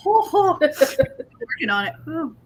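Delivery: talking through a hot mouthful; words around a bite of spicy food; mouth full of hot food. Real, not acted. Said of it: "Oh, we got something spicy".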